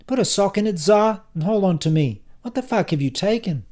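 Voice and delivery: high, loud telling-off; exasperated scolding. Acted. Silvio helps Tsar walk away, scolding high and loud.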